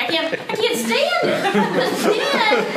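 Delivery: high, slow whine breaking into a laugh; mocking the way TV kids whine; whiny kid voice. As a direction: imitate a whining cartoon kid. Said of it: "kinda talk like this".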